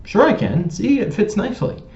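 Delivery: high, playful and defiant; cheeky retort. Retold or imitated. To the stranger who says he can't wear it, a high playful comeback. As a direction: tease her while putting the hat on. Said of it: "put it on my head and said".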